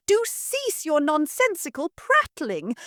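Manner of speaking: very high, shrill-pitched commanding matron; imperious mistress. Acted. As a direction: order the maid about from on high. Mother rebukes daughter.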